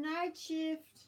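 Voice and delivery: slow, yawning words. Real, not acted. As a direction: talk through a yawn. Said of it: "Stop yawning".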